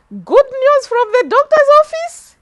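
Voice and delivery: high, loud incredulous question; can't believe the good news; amazed. Retold or imitated. Asking the doctor in disbelief, high and amazed.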